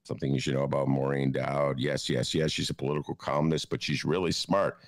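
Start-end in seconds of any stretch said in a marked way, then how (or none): none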